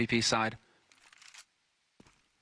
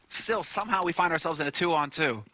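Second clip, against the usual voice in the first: ordinary talk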